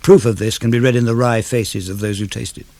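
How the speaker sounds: normal talking voice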